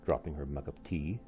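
usual speaking voice

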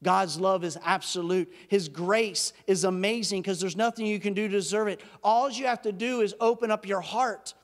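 ordinary talk

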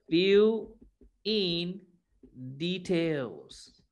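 each word fill, in, details set apart with long pauses; kids show host. Shown as a demonstration. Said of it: "Fill in the details on the graph first".

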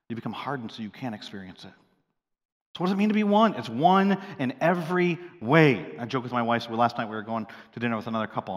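ordinary talk

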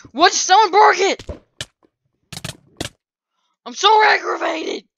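high, loud furious cry; outraged gamer shout; angry shout. Real, not acted. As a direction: yell it in real frustration. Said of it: "I'm so angry about that".

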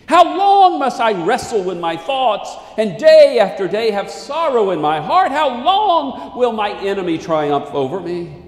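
pleading, rising psalm reading; lament psalm reading. Acted. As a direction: cry the psalm out to God. Preacher reads lament psalm aloud.

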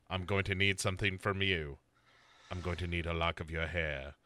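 very low, quiet creepy killer voice. Retold or imitated. Bo as the killer asking Sarah for her hair, low and creepy. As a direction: breathe it low and sinister. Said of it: "he's like".

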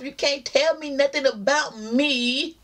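usual speaking voice

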